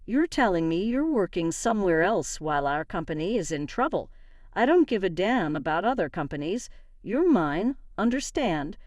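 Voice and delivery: usual speaking voice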